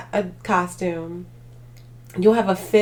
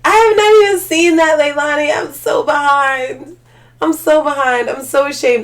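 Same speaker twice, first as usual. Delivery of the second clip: much higher and louder, gushing apology; loud mock shame, all in a rush; excited exclaiming; 6 semitones higher, 9 dB louder than usual. Real, not acted. Vlogger loudly confessing to chat she hasn't seen it, higher and louder. Caught not seeing the show.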